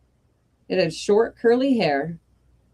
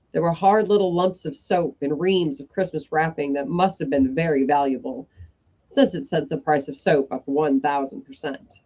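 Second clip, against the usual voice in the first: plain reading voice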